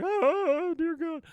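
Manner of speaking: very high, loud mock wail; terrified wail. Man hiding from his attacking dog, terrified wail. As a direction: wail in hammed-up terror. Bill hides from pounding dog.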